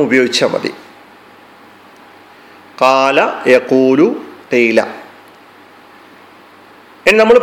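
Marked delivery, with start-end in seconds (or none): 2.8-4.8 very slow, each long vowel in കാല, യഖൂലു, ഖീല held for two beats; teacher demonstrating the natural madd length. Shown as a demonstration. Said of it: "രണ്ട് അക്ഷരങ്ങൾ പറയാൻ, ആ, എത്ര സമയമാണോ വേണ്ടത്". (ഓരോ ദീർഘവും രണ്ട് മാത്ര നീട്ടി പതുക്കെ ഉച്ചരിക്കുക)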